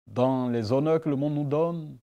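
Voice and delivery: normal talking voice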